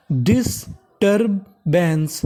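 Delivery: Disturbance drawn out slowly, sound by sound; slow syllable by syllable. Shown as a demonstration. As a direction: model disturbance slowly for learners. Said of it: "तो meaning बन गई". (धीरे-धीरे शब्द तोड़कर)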